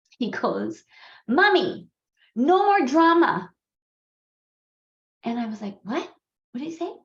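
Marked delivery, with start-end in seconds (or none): 1.3-3.5 loud, high imitation of a child calling; son calling out. Son in the bathtub calls to his mom, loud imitated shout. 5.8-6.9 retold baffled question, jumping high; puzzled surprise. Mom mishears her son from downstairs, retold puzzlement. Puzzled reaction to son's shout.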